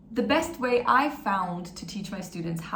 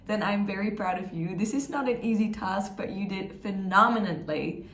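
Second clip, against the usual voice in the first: normal talking voice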